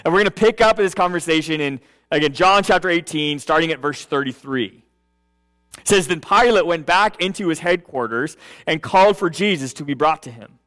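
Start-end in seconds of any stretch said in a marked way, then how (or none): 6.1-10.6 scripture reading. Acted. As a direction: read the passage with hushed reverence. Reads scripture aloud.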